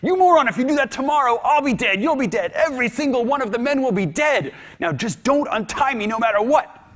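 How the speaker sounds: very high, loud, fast furious rant; flipping out, roaring at the first mate; furious yelling. Acted. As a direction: scream at the first mate in a rage. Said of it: "Odysseus flips out".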